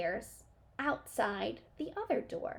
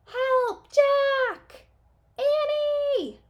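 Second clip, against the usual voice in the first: very high, loud frightened cry; loud panicked cries; cry for help; 9 dB louder, much slower than usual. A narrator's reading. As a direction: call for help from far away. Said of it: "Her cry seemed fainter".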